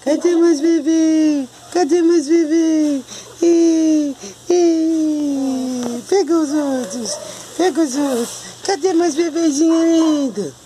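very high, drawn-out cooing. Real, not acted. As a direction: squeal sweetly for the little puppies. Gushing over the puppies. (voz bem fininha e arrastada de bebê)

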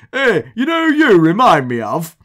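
loud, caricatured Oldham heckle; broad Oldham accent. Retold or imitated. Oldham fan stopping a rival manager, thick Oldham accent. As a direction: call out like an Oldham football fan. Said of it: "says in a fantastically Oldham accent".

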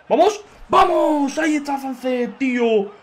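high, loud double shout of joy; goal celebration. Real, not acted. Streamer celebrating his sub's goal, loud joyful shout.

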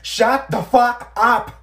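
slow, loud, furious yell. Retold or imitated. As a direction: scream it at her. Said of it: "so I was just like".

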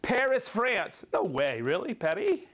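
ordinary talk

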